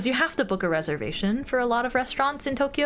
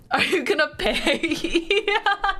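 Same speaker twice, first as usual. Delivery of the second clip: laughing; 7 semitones higher than usual. Pretend. Acted cashier, laughing.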